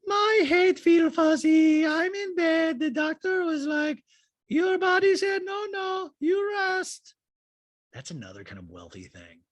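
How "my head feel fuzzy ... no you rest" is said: very high, slow, whining caricature; Diana. Retold or imitated. Friend FaceTiming about being sick in bed, exaggerated high-pitched impression. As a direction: drag it out in a squeaky, put-on voice. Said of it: "we see Diana, uh, FaceTiming Rinna going".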